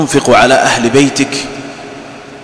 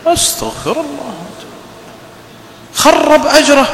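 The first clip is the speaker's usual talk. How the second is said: much higher, shocked outcry; dismayed exclamation; 10 semitones higher than usual. Real, not acted. Preacher reacts to a father cursing his children, higher and shocked. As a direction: recoil in mock horror.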